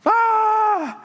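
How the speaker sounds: a sudden high shout of alarm, "Ah!"; retold scream. Coworker screaming in the mine corner, loud high yell. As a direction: let out a loud startled yell. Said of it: "and he's screaming".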